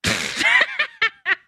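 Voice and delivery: high, sudden laugh; burst of laughter. Real, not acted. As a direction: laugh out before you can stop. Laughs at Snowy fearing Buwaro.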